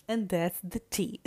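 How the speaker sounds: loud, sassy sign-off of the gossip; loud sassy gossip closer; sassy punchline. Acted. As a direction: deliver the verdict like spilling tea.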